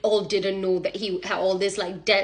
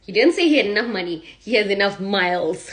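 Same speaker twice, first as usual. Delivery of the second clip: ordinary delivery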